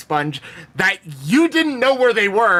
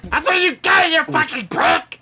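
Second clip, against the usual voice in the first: high-pitched gloating holler; vengeful yell; 7 semitones higher than usual. Acted. Mange gloating at the man Skitry shot, vengeful yell. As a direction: crow it angrily over the body.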